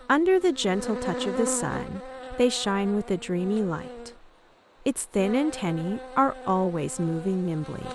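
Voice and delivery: ordinary delivery, nothing marked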